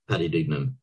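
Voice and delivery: usual speaking voice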